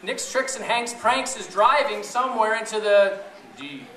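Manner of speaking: usual speaking voice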